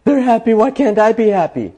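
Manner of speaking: gasping, high-pitched envious complaint; gasp, then wail it in mock envy; envious scarcity mindset. Speaker mimicking people comparing themselves, envious. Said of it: "looking with a scarcity mindset and going".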